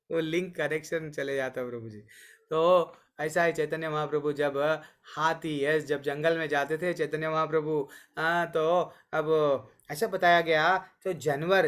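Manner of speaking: ordinary talk, nothing marked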